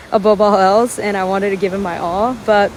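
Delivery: ordinary delivery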